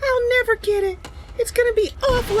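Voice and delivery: whiny despair. Retold or imitated. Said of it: "I was like".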